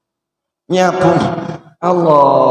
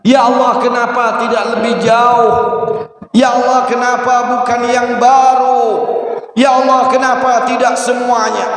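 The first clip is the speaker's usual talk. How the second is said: high, mildly raised replay of dying cries; retold shouts; 6 semitones higher than usual. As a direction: recite the three cries high, only half shouted. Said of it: "bojoku bengok-bengok itu tadi". (menirukan teriakan, setengah berteriak)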